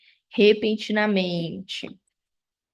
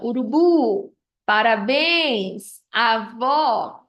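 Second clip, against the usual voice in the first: "urubu", "parabéns", "avó", each last syllable drawn out; slow demonstration of last-syllable stress in "urubu, parabéns, avó"; final-syllable stress; 3 dB louder, much slower than usual. Shown as a demonstration. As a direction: lean long on the "bu", "béns" and "vó" endings. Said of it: "quando a sílaba é a última".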